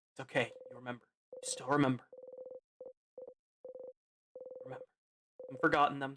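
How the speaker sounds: calm someone on the edge of tears; fading into a hushed comfort; gentle reassurance. Acted. Comforting Siffrin, remembering friends.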